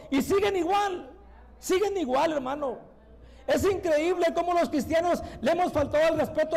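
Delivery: forceful, urgent church exhortation; impassioned preaching. Acted. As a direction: press the congregation with fervent reproach.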